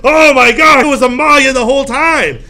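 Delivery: high, loud put-on shock; exaggerated loud gasp of realization; mock shocked outcry. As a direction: cry out in hammed-up astonishment. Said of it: "it'd be like".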